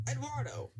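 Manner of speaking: high call. A narrator's reading. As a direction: call for the engine across the yard. Calling Edward wrongly.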